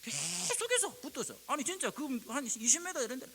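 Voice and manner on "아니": normal talking voice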